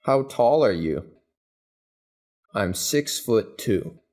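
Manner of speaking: normal talking voice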